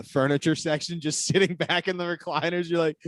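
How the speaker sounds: normal talking voice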